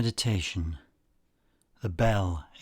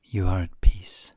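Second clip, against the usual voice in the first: unhurried guided-relaxation delivery; guided meditation; 6 semitones higher than usual. Acted. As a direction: lead listeners calmly, phrase by phrase, leaving space after each.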